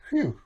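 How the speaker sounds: high, quick sigh of relief; relieved phew. A narrator's reading. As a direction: let the breath out in relief. Relief after landing.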